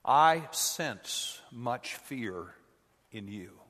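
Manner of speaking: very slow, grave Yoda line; slow solemn quote. Retold or imitated. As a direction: draw it out slowly and gravely. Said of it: "what the Holy Spirit would say".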